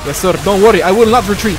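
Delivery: loud, high soldier reply; eager soldier. Pretend. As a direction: answer like a fired-up soldier. Answering the game officer in role.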